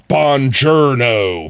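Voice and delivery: flat slow American "Buongiorno"; anglicized Italian greeting; American accent. Shown as a demonstration. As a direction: say it like a tourist. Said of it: "I did both of those terribly".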